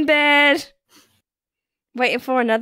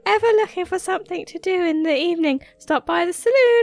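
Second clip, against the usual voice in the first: put-on much higher, sing-song girly voice; bubbly young woman; 9 semitones higher than usual. Voicing the barmaid inviting the farmer, high and bubbly. Reading Emily's dialogue aloud.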